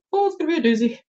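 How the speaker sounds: high-voiced playful warning; playful exclamation. Real, not acted. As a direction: pitch it way up in mock dread. Repeats it for playful emphasis.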